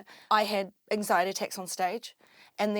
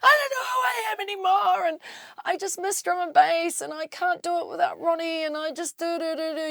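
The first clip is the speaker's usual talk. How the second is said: put-on drunken wail; 8 semitones higher, 4 dB louder than usual. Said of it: "drinking this bottle of whiskey and I'm like".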